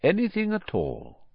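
tender, open-ended reassurance; gently. A narrator's reading. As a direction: gently invite them to confide. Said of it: "he said gently".

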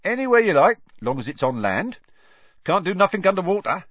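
high, loud boastful youth; proud Cockney conductor. Acted. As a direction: brag about the bus. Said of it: "said Stan proudly".

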